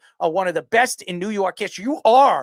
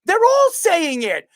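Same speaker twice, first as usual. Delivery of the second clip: worked-up sports talk rant; heated rant; 11 semitones higher, 4 dB louder than usual. Real, not acted. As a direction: hammer it home, getting louder. Heated rant about Stephen A.'s crew.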